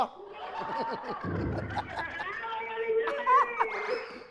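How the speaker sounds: long laugh climbing high; drawn-out real laughing fit. Real, not acted. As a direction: laugh on and on, rising in pitch. Host laughs at wrong answers.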